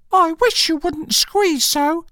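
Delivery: very high squeaky little creature; complaining Dormouse. Acted. Dormouse complaining to Alice, tiny high squeak.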